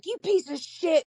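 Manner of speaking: high mock-angry insult, imitating her old self; retold angry call. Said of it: "I would be so mad".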